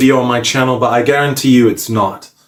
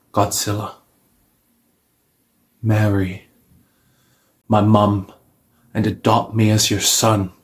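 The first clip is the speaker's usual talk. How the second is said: mock solemn proposal; 2 semitones lower, 3 dB quieter than usual. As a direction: pop the question with long pauses. Acted kneeling proposal to Godzilla.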